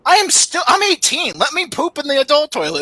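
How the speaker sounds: high, pleading whine; mock high-pitched plea; whiny plea. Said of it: "I'm like".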